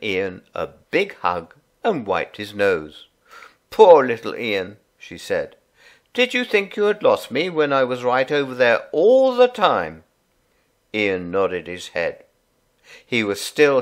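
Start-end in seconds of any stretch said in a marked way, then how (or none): 3.7-4.8 tender mum. A narrator's reading. Mum hugging her son. 6.1-10.0 soothing mum. A narrator's reading. Mum soothing her son.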